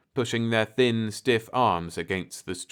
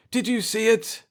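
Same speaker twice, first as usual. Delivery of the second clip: higher, elated call; excited cry; 13 semitones higher than usual. A narrator's reading. Pete to Jeff after the ship vanishes, excited. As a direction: cry out in wonder. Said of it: "he cried".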